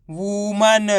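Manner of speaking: Woman with no breaks inside; blended word. Shown as a demonstration. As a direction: say woman joined up as one word. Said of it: "तो मिलके बन जाएगा". (Woman को मिलाकर एक शब्द में)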